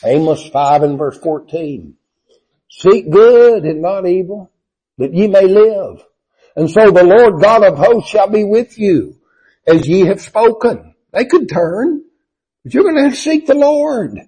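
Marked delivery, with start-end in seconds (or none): 2.7-14.2 full-force preaching. Acted. Preacher proclaiming Amos to the congregation, loud and high. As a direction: lift the verse over the whole church.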